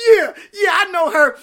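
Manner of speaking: loud, high, boastful brush-off; proud high shout of self-confidence; cocky radio diva. Retold or imitated. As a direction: play it like the star who knows it. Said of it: "be like".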